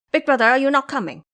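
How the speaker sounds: blurted in surprise; surprised pig disciple. A narrator's reading. Ba Jie startled that his brother won't come.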